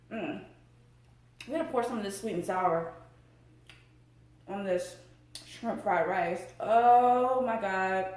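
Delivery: usual speaking voice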